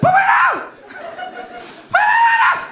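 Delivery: mock big man voice, shrieked way up high; comically high shouted "manly" voice. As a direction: squeal it in panic as your supposed manly voice. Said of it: "I use my, my big man voice".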